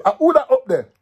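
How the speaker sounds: high, loud shout up the tree; soldier's call. Acted. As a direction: demand who's hiding up there.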